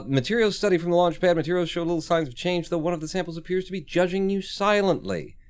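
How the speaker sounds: high, fast announcer read. Acted. Reading experiment result text.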